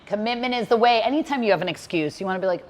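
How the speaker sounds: usual speaking voice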